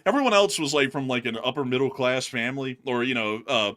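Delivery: normal talking voice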